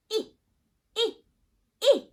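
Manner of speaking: "I" said as the short vowel of "in", clipped and repeated; short i sound. Shown as a demonstration. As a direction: say "I" as a short, clear /ɪ/ sound, three times. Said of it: "the sound it makes is I".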